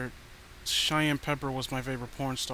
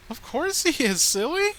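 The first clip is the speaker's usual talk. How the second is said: much higher, louder sing-song giggly voice; creepy sweet girlfriend; 11 semitones higher, 6 dB louder than usual. Acted. Danielle telling Danny she killed Trent, creepy sing-song. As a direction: chirp it like a cheerful psycho.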